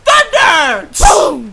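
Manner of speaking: shouted. Pretend. Shouting sound effect.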